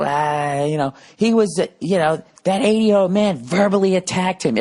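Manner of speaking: high-pitched imitation of an indignant woman; mocked woman. Woman accusing him of attacking old man, mocked high voice. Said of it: "then the fucking woman".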